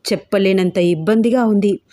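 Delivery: ordinary delivery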